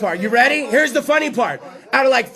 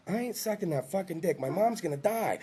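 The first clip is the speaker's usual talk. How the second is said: muttered resolve; 5 semitones lower, 12 dB quieter than usual. Acted. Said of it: "on my way home going".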